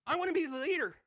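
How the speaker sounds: put-on high, loud bossy kid; childish shout. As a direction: shout it like a kid grabbing the lead.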